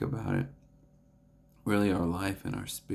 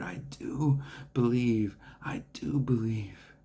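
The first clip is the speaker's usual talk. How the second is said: slow breathless whisper of faith; straining whisper; 3 semitones higher, slower than usual. Retold or imitated. A desperate father whispers his belief to Jesus, slowly. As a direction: whisper it slowly, mustering every bit of faith. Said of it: "trying to muster everything in his heart".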